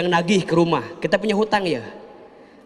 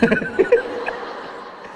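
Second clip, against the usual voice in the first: high giggle. Real, not acted. Laugh after joke.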